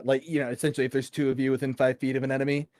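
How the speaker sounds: ordinary talk, nothing marked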